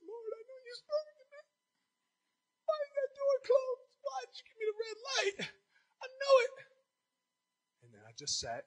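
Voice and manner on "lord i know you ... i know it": high, breaking tearful plea; pleading through tears; crying. Retold or imitated. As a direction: sob it out to the Lord. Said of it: "I cried like a baby".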